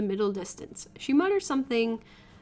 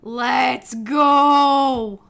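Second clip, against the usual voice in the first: 1 excited shout; 10 dB louder than usual. Real, not acted.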